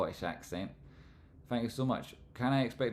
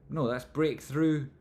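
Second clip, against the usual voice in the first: usual speaking voice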